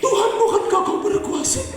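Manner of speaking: high, laughing, whiny plea to God; mock wailing prayer. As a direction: cry out too late. Doa terlambat, mengejek. (doa meratap pura-pura)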